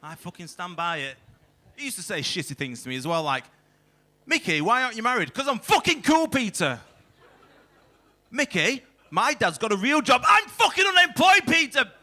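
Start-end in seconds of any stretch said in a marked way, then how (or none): 4.3-5.3 high, fast mocking child's taunt; mocking kid voice. Comedian mocking kid's taunt. 5.3-6.8 much higher, louder sarcastic comeback; mock shouted retort. Mocking his own retort. 8.3-11.9 much higher, louder, building to a yell; mock shout. Acting kid and his reply.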